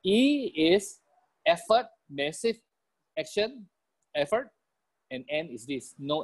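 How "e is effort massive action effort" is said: usual speaking voice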